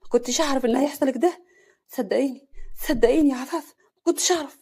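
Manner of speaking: rising, loud anguished plea; pained. Acted. Husband pleading with his wife, pained and loud. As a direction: beg her to believe him. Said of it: "حسن بوجع".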